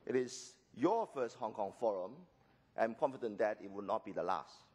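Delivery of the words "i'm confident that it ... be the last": ordinary delivery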